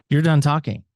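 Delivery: military tone. Retold or imitated. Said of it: "in his military tone".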